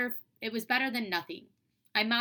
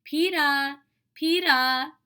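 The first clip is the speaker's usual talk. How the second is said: loud high call from far off; 7 semitones higher, 9 dB louder, much slower than usual. A narrator's reading. Toddler on the jungle gym calling his brother, loud. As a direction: call out to get his attention. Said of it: "until he called".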